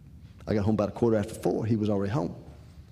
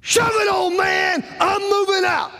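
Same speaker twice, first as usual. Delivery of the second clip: much higher and louder, yelled outburst; furious shout; 6 dB louder, slower than usual. Retold or imitated. Son yelling at his father that he's moving out, furious shout. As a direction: blow up and scream it at him.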